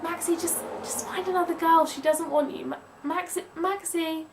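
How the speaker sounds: gently begging him to give up; exasperated plea. Pretend. To Maxi, telling him to move on: exasperated plea. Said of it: "You just wanna say to him".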